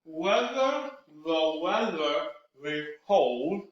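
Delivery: slow, each syllable drawn out around the fingers; with fingers in mouth, very slow. Shown as a demonstration. Cork work tongue twister with fingers in the mouth. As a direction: articulate every syllable slowly. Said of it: "One more time".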